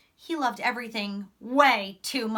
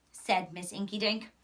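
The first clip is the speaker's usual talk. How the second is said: usual speaking voice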